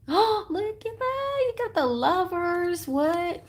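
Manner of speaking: high, excited squeal of surprise; gasping, drawn-out amazement at a lucky find; delighted surprise. Real, not acted. Gasps at drawing the Lovers card.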